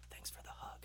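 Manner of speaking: whispered doll voice; creepy doll whisper. Retold or imitated. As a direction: breathe it like a haunted doll. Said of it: "a doll that was in her room that said".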